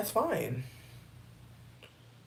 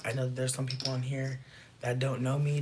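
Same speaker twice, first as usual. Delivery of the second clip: ordinary talk, nothing marked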